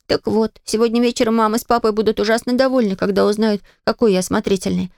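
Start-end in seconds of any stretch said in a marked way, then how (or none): none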